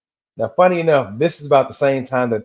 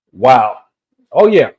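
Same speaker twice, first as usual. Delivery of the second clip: surprised wow; 7 dB louder, slower than usual. Real, not acted. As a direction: caught off guard, blurting it out. Reacting to something on screen.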